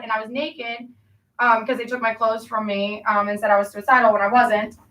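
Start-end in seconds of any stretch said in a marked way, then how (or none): none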